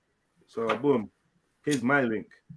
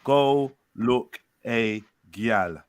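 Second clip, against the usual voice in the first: slow drawn-out; 4 semitones lower, much slower than usual. Acted. Slow drawn mock patois reply.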